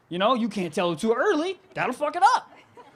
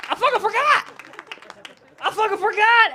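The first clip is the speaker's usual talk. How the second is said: excited outburst; 10 semitones higher than usual. Real, not acted. Comedian forgot to tell the crowd, high excited yell. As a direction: cry it out as a sudden realization.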